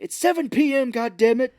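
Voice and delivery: loud, high exasperated outburst; mock exasperated yell. Santa fed up with his elves, mock loud yell. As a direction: bark the time like a fed-up boss.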